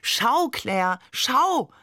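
tug at her sleeve with your voice; eager urging. A narrator's reading. Father urging wife to look.

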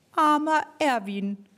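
a player mildly pitying Erwin; pitying call. Acted. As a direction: feel sorry for poor Erwin. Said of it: "rief irgendwer".

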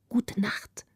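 hushed, furtive good night; secretive whisper. A narrator's reading. Girl secretly bidding the prince good night, whispering. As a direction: whisper it so only one person hears. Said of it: "winkte dem Prinzen heimlich".

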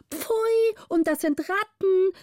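high, shrill-free chorus of pages in alarm; pages crying out. A narrator's reading. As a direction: squeal it out high like startled page boys. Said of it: "riefen alle Pagen mit großem Geschrei". (hoher, entsetzter Aufschrei der Pagen)

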